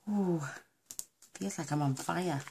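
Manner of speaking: ordinary talk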